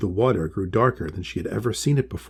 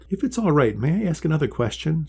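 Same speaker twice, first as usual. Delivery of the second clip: asking quietly; 7 semitones higher than usual. Acted. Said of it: "she said quietly".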